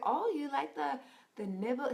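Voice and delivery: lightly voiced older Indian counselor, teasing; a hint of the older Indian gentleman, playful; imitating the counselor. Mimicking the counselor asking the couple about intimacy, light teasing voice. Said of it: "He's like".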